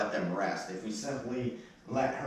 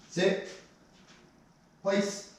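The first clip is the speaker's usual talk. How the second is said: sharp commands; 11 semitones higher than usual. Real, not acted. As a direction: call each command out firmly. Trainer commanding dog.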